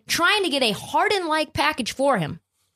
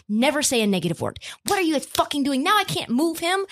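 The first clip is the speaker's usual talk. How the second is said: ordinary talk, nothing marked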